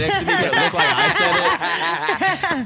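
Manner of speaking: high stretched laugh. Real, not acted. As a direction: say it through laughter. Laughing through punchline.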